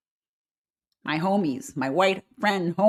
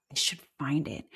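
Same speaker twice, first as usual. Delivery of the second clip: whisper; 3 semitones lower, 8 dB quieter than usual. Real, not acted. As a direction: breathe it, searching. Searching phone.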